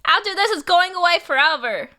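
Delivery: loud, high, indignant protest. Real, not acted. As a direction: loudly refuse. Protesting meowing bit, loud.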